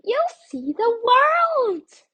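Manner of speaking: very high, loud tiny ant; a teeny, eager insect voice. A narrator's reading. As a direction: squeak out the promise of adventure. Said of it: "said a tiny ant".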